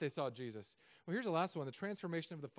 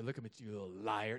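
mock menacing; 7 semitones lower than usual. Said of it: "I say".

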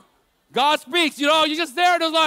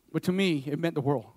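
heartfelt, hushed; 9 semitones lower, 6 dB quieter than usual. Real, not acted. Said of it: "emotional emphasis".

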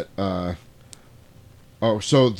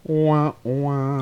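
higher vocal sting; drawn-out mouthed sound effect; imitated "wah wah"; 5 semitones higher than usual. Punctuating the wheelchair's name, imitated wah-wah sting. Spoken sound gag after film title.

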